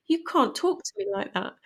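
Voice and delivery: replaying the son standing up to his mother; son yelling back. Son shouting back at his mother, indignant and high. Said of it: "he screamed back at me".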